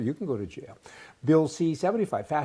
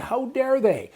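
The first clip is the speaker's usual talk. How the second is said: loud, high mock indignation; mock furious chief; retold outrage; 9 semitones higher, 6 dB louder, slower than usual. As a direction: imitate his outraged chief. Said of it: "he went wild on this".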